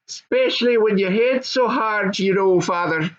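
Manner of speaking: put-on high young goblin son. Goblin son answering his dad, high and eager.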